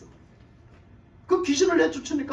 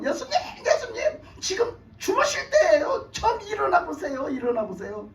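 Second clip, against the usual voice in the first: high-pitched, urgent mock pleading; frantic pleading; 7 semitones higher than usual. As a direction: act out panicked disciples waking their master. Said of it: "고해 바쳤어". (다급하게 매달리는 제자들 흉내)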